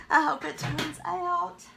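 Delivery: nervous laugh. Real, not acted. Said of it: "I'm so nervous about this".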